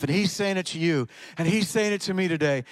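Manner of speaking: ordinary talk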